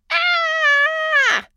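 put-on high, loud screaming little kid; child's scream. Small child screaming for mom and dad in alarm. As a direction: scream in alarm like a four-year-old. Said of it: "our four-year-old screamed".

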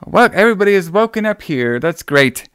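high, sing-song forties-movie mother; mocking the aunt's strange chirpy acting; over-the-top mom. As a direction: ham it up like an old-fashioned movie mom. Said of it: "her acting is basically like".

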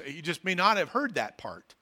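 normal talking voice

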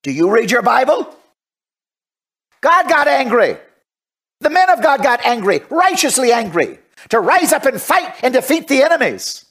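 high, impassioned righteous preaching; fiery preaching. Real, not acted. Preacher defends righteous anger to his critics, fiery preaching.

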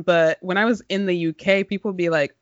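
ordinary delivery

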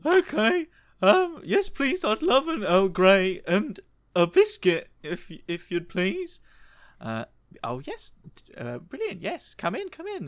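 high, eager, very polite Englishman; posh polite voice. Acted. John accepting tea from Margaret, high polite voice. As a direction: pitch way up and play it prim.

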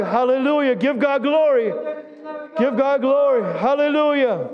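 high, ringing worship exhortation; exultant praise. Real, not acted.

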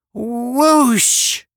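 high, loud drawn-out whoosh; imitated whoosh. A narrator's reading. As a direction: imitate a rushing blast on one held high note. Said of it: "there was another sound at his command".

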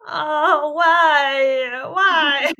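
hammed-up high, loud whine of "why"; mock crying out against an early phone alarm; mock wailing. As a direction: wail like a put-upon martyr, loud and high. Hamming up her own morning complaint.

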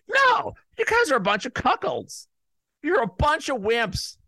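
high-pitched angry shout; indignant outburst. Real, not acted. Host rebukes commissioner services that go along with the NFL, indignant outburst. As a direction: burst in and shout them down.

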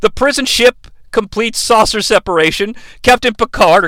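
ordinary talk

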